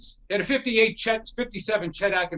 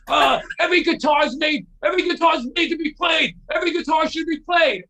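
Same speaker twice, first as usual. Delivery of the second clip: put-on raised, higher voice of a pushy kid insisting; indignant retort; 7 semitones higher, 4 dB louder than usual. Kid argues back about the guitar, raised and insistent. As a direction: shout the kid's comeback like it's obvious.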